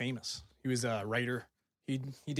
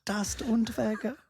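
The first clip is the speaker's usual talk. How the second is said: mock German accent; 9 semitones higher than usual.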